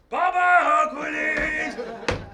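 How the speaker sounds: high, loud, drawn-out goodbye; mocking farewell call. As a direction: sing out the goodbye. Farewell called out.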